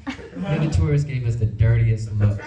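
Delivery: ordinary delivery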